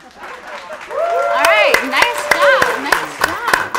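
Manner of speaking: high, loud congratulations over clapping; cheering applause. Real, not acted. Host congratulating a guest after his impression, loud high cheer. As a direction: whoop your praise for the performer.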